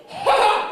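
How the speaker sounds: one high, loud shrieking "ha"; sudden piercing mock laugh; acted scream-laugh. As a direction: burst out with a single screamed laugh. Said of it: "I scream, laughed, I just go".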